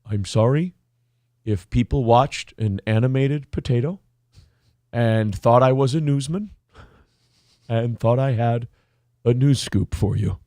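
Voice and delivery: low, measured reply with long pauses; deadpan mock apology. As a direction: say it low and unruffled, pausing between phrases. Potato's deadpan reply, retold.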